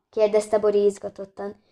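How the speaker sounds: usual speaking voice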